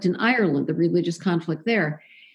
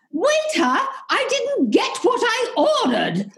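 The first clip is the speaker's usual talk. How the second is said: much higher mock British complaint to the waiter; snooty British tourist; 14 semitones higher than usual. British tourist in a Spanish restaurant complaining to the waiter, mock posh. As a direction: ham up an indignant upper-crust Brit. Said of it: "you hear somebody going".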